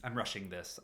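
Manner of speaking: ordinary delivery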